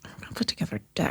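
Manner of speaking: quick breathy whisper, faking she knows; whispered mock confidence. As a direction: whisper it fast as if bluffing. Imitating herself, fake confidence.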